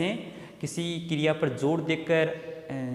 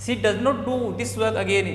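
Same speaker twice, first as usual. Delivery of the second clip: slow dictation; 4 semitones higher, much slower than usual. Shown as a demonstration. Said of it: "meaningful sentence बनाता हूँ".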